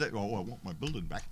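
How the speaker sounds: soft mocking imitation of the neighbor; mocking whine. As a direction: sneer it as a whiny demand.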